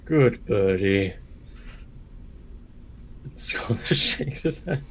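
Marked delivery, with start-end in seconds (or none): 0.0-1.1 put-on old master's voice. Bird mocking his old master's voice. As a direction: mimic the old teacher's patronising calm. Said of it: "old master's voice kinda goes".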